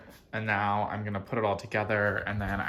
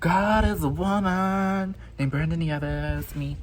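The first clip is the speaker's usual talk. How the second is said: diva proclamation; 6 semitones higher, 4 dB louder, slower than usual. Acted. Self-proclaimed diva line.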